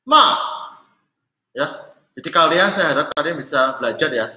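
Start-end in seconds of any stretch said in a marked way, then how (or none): 0.0-0.2 short, loud MA falling from high; fourth tone. Shown as a demonstration. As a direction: drop MA sharply from a high start. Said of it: "Yang terakhir".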